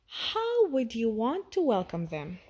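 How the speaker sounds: usual speaking voice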